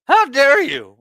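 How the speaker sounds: high, loud squeaky outrage; voice-breaking teen outrage; squeaky how dare you. Retold or imitated. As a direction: crack the voice like a pubescent boy. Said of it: "Why does he sound like a twelve-year-old going through puberty".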